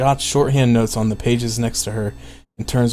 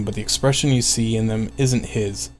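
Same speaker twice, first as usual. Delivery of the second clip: ordinary delivery, nothing marked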